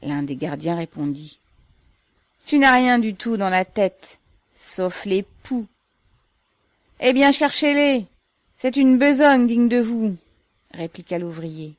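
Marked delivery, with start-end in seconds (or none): 2.5-5.7 loud, raised-voice insult; guard jeering back loudly; scornful retort. A narrator's reading. Guard trades insults with worker. 7.0-10.2 loud, high-pitched comeback; defiant retort. A narrator's reading. Worker snaps back at guard.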